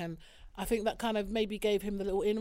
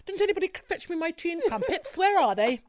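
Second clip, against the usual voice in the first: lightly posh Queen's English voice, pitched up; posh English accent; 5 semitones higher than usual. Shown as a demonstration. Joking about a breakfast show interview in a posh English accent. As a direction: play a prim posh English lady, lightly. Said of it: "I would speak like this".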